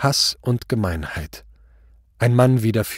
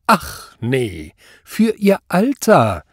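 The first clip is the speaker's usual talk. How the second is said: high, slow, stretched sarcasm; loud, sneering judge; mocking echo; 4 semitones higher, 4 dB louder, much slower than usual. A narrator's reading. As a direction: feign amazement to ridicule him. Judge mocks the answer.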